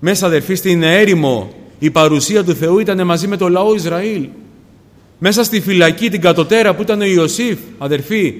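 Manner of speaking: loud, high, urgent sermon voice; impassioned preaching. Real, not acted. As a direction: preach at full force, crying out to the congregation. Preaches at full force.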